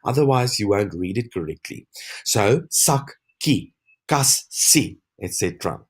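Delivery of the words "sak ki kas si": "sak, ki, kas, si" broken into separate short syllables; split at doubled consonant. Shown as a demonstration. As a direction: pause inside each word: "sak, ki, kas, si". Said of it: "have to double the S and the K".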